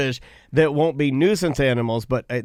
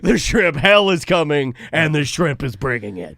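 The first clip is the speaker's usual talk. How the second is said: dramatic Steven; 5 dB louder than usual. Retold or imitated. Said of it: "Steven's like".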